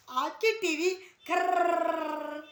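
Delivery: a loud rolling khrrr like a broken TV; crackling static noise. Retold or imitated. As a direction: mimic TV static, slow and loud. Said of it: "ऐसे होगी".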